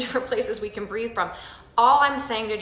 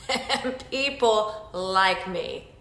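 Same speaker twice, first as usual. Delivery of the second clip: slow, laughing punchline; much slower than usual. Pretend. Ending the mock affirmation to the group, laughing slowly. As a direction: laugh through the last words. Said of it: "be like".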